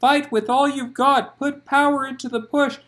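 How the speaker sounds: loud, high urgent coaching; spurted urging. Acted. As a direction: spur her on hard. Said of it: "she spurted".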